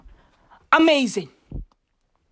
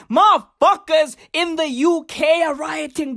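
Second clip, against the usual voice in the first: ordinary talk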